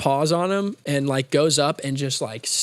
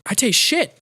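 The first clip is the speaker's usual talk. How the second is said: higher-pitched mock bewilderment; disgusted boss; 8 semitones higher than usual. Boss just unpaused, high comic disgust. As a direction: puzzle over a foul taste, pitched up. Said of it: "presses play, and he's like".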